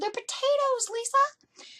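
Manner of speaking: very high, sing-song insistence; insist, holding back a laugh; a very high, teasing mom voice; insistent mom. Retold or imitated.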